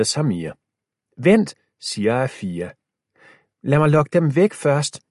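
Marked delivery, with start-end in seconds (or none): 1.2-1.5 high, loud "wait"; urgent call. A narrator's reading. Sister stopping her brother and Milo, urgent call. Afia stops them urgently. 3.6-5.0 higher and louder, pressing; urgent call. A narrator's reading. Girl telling the boys to wait, raised voice. Afia plans luring zombies.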